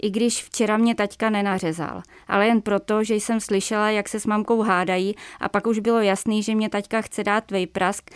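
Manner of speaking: plain reading voice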